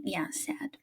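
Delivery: dropping to a hush mid ghost story; hushed aside. Acted. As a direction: drop to a hush.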